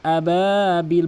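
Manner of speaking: slow, long-drawn tajweed chant; measured Quranic chanting; Quran recitation. Acted. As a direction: recite with stretched, sustained vowels.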